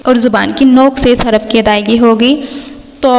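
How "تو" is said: طو (written تو) thick, from the tongue tip; heavy letter demo. Shown as a demonstration. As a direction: say تو full-mouthed. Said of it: "موٹا ادا کرنا ہے". (تو مفخم، موٹا)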